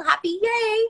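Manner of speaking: high, loud chirpy yay; sweet little girl. Retold or imitated. Mocking her six-year-old part, high and chirpy. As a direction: squeal it like a happy little kid. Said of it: "was like a sweet little".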